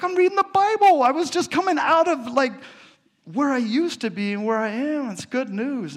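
very high, fast, flustered voice; mock eager self. Himself answering the woman, squeaky and flustered. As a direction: gush nervously like a defensive kid. His defensive reply, retold.

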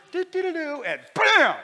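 high sing-song do-dos breaking into a loud bam; acted sudden bam. Preacher mimicking the word suddenly hitting a reader, high noises and a booming bam. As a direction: trill it high, then hit BAM hard.

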